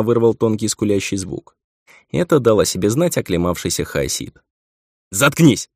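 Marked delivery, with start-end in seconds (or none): 5.1-5.7 sharp angry bark; irritated snap. Acted. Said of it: "раздражённо рявкнул я".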